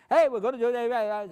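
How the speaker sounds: put-on shouty children's presenter parody; mock kids' TV shout. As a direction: ham up a children's TV host yelling. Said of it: "You don't shout".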